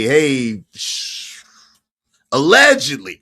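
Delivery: ordinary talk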